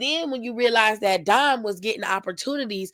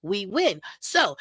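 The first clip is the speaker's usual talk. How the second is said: normal talking voice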